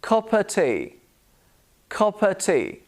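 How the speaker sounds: "of" reduced to "uh", v dropped; weak "uh" for "of"; schwa in OF. Shown as a demonstration. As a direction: cuppa tea, said carefully for learners. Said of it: "use it with the word of".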